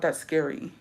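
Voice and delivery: ordinary talk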